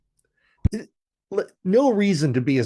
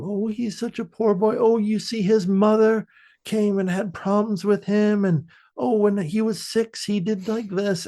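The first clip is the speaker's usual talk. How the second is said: higher, fussy doting granny; pleading grandmother; 9 semitones higher than usual. Retold or imitated. Friend mimics grandmother defending her grandson, higher. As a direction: plead for the poor boy, fussing.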